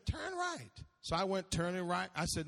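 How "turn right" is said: high-pitched imitation of a sat-nav lady; high, detached navigation prompt; GPS woman's voice. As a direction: give a direction as a mock GPS voice. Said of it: "it's a woman's voice".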